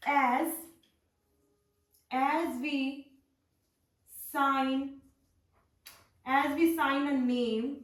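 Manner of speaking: word by word, very slow with long gaps; slow dictation. Shown as a demonstration. Teacher dictating a note to her class, word by word. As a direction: spell it out slowly for students writing notes.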